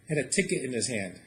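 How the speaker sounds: ordinary delivery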